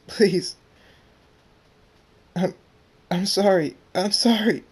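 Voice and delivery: high, loud tearful pleading; desperate begging. Acted. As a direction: beg for your life, halting between apologies. Tearful character begging for his life.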